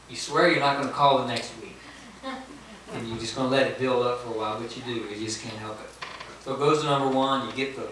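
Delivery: usual speaking voice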